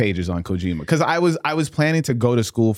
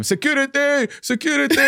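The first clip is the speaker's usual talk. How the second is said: high mocking call for security; students mimicking teacher. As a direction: parrot the teacher's squeaky cry. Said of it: "they just mimic him".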